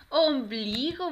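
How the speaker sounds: loud, drawn-out, joined-up "Ombligo"; triumphant "Ombligo" for the children; cheering the answer. Shown as a demonstration. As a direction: celebrate the right answer.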